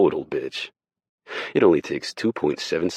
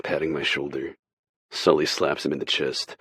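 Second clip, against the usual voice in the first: plain reading voice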